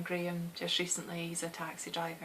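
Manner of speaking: normal talking voice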